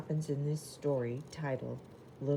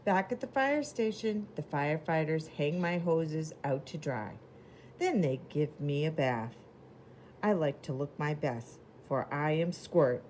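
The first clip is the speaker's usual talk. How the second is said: lively picture-book reading voice; reading to children. Acted. Teacher reading a storybook to the class, lively. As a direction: read it bright and clear for little kids.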